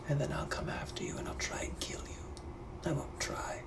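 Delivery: soft, unvoiced threatening whisper; a hunter's teasing whisper; menacing whisper. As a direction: whisper the threat into their ear. Hunter taunting his prey.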